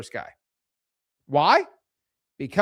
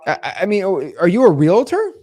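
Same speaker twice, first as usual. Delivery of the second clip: loud, surprised seller on the phone; pretend home seller; 4 semitones higher, 8 dB louder than usual. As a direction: play a caught-off-guard homeowner. Owner plays a seller on call.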